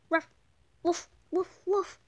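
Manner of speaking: loud high mock woofs; excited loud yapping; loud barks. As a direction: bark it out loud. Shopkeeper recognizes wolf.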